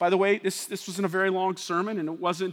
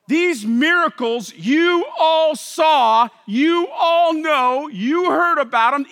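loud, slow, higher proclamation; preacher acting out Peter; voicing Peter; 5 semitones higher, 6 dB louder, slower than usual. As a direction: proclaim it to the crowd, drawn out.

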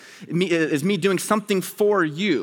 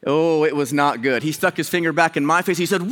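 usual speaking voice

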